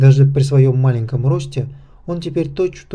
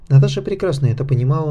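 usual speaking voice

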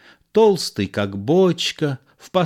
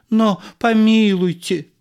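pleading protest; 10 semitones higher, 4 dB louder than usual. Acted. Actor pleads with heckler.

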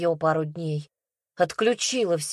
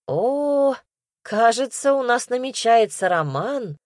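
higher, put-on cheerful tone; fake delight; 5 semitones higher, 3 dB louder than usual. A narrator's reading. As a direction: feign being thrilled. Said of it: "фальшиво обрадовалась Оля".

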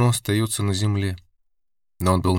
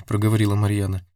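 ordinary delivery, nothing marked

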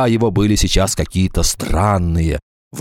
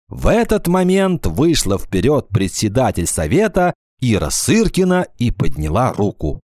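plain reading voice

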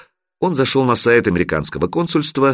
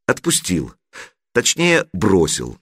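ordinary delivery

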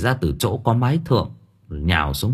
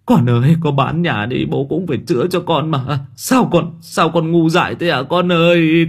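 higher, louder crying plea; sobbing lament; 7 semitones higher, 3 dB louder than usual. Acted. Father sobs over his dying daughter, pleading. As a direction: wail through tears over a lost child. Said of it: "bác Hùng mếu máo".

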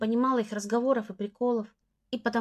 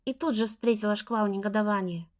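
ordinary delivery

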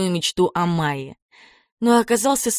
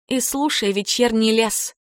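ordinary delivery

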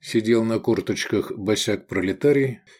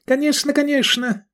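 sehr hohe, eilige Greisenstimme; geschäftiger alter Mann. A narrator's reading. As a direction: der Alte beeilt sich zu dienen. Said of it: "засуетился старичок".

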